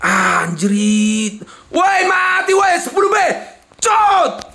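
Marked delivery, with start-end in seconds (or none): 1.7-4.5 sudden high yell at the win; excited shout. Real, not acted. Player yelling at a slot hit, excited shout. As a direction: shout it out, thrilled.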